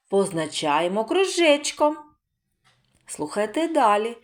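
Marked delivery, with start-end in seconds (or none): none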